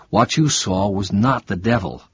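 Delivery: ordinary delivery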